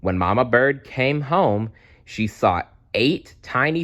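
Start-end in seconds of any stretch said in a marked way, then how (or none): none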